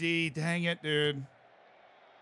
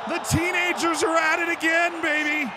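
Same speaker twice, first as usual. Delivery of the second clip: excited; 13 semitones higher, 5 dB louder than usual. Real, not acted. Celebrating win.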